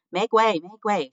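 loud, high-pitched call to clear a path; shouting. A narrator's reading. Furlough pushing through the crowd of mice, shouting. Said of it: "shouted Furlough".

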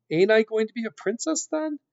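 put-on high-pitched simple serving girl; questioning serving girl. A narrator's reading. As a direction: ask it high, as a puzzled girl. Mig questions the rat.

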